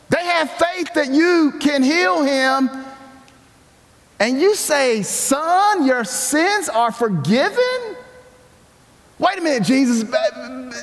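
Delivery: high, incredulous questioning; mock disbelief. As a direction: argue with him in astonishment. Preacher questions Jesus in mock disbelief.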